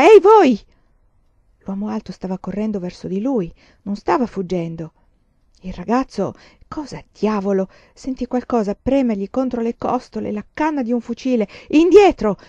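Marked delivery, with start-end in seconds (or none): 0.0-0.6 grido forte e acuto verso la strada; urlo. A narrator's reading. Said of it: "urlò nella strada". 11.7-12.4 loud, high command to back off; sharp order. A narrator's reading. Ordine con fucile puntato.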